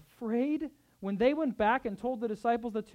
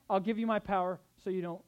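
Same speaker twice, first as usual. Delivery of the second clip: usual speaking voice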